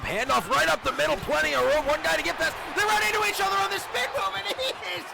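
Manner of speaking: rising to a screaming touchdown call. Acted. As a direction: build to a full shout, he's gone. Touchdown run called live.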